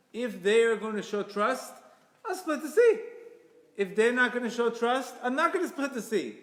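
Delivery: loud, high-pitched ultimatum; voicing God's forceful terms; emphatic declaration. Acting out God's conditional offer.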